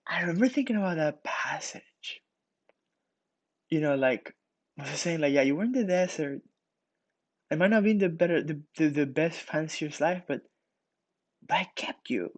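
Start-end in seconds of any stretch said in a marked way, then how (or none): none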